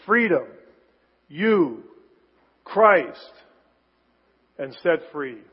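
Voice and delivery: Freedom, you, Christ, set free, each word punched with long pauses; slow, emphatic word-by-word delivery; four words stressed. Shown as a demonstration. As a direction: hit each word alone, then let it sink. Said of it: "that first sentence is really just four words".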